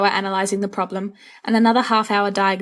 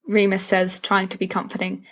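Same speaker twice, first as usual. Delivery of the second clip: ordinary delivery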